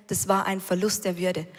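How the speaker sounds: usual speaking voice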